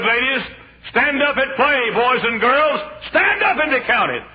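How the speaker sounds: loud, high, rising sermon cry; fiery preaching. Acted. Preacher booming the story of Obadiah to the church. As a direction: preach it out to the congregation at full force.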